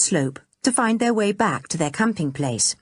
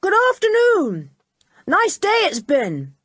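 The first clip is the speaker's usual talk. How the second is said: loud, high cheerful call uphill; cheerful shout; 14 semitones higher, 4 dB louder than usual. A narrator's reading. Julian calls up to the old man, loud and cheerful. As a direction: call out a friendly greeting. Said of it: "He shouted out cheerfully".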